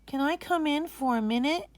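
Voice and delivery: put-on high, small boy's voice asking gently; a little brother asking to come in; timid young boy. Young boy asking his sister timidly. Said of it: "he asked".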